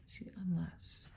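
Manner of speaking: hushed whisper of unless; teacher whispers the word to the class. Acted. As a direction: breathe unless like a secret.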